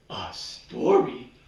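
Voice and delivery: low, slow alarmed gasp of a question; shocked. Acted. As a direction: recoil at the very idea. Panicked at being asked.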